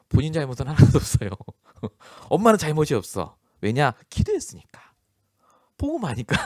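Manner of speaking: loud, high sarcastic jab at the mother; mock sarcasm. Pastor mocking the blameless mother, sarcastic and loud.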